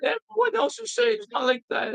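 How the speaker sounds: very high, whining mock falsetto; dismissive student. Student denying that he sounds angry, high mocking whine. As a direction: mimic a student squealing in denial. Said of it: "And he's like".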